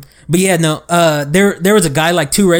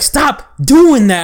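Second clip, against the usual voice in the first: higher, slow, stretched-out annoyed order; mock the customer's rising temper, dragging it out; retold exasperated snap, pitched up and slowed; irritated demand; 7 semitones higher, 3 dB louder, much slower than usual. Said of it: "what he's getting upset about".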